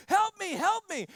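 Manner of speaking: mock panicked kid, high and loud; hammed-up cries of help me, high and loud; imitating the kid. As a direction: play the grinning kid yelling for help. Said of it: "he's gonna use his English".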